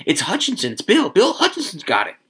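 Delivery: high, rising crowd buzz; excited announcing. A narrator's reading. Villagers passing on who got it, excited. Crowd voices spreading the news.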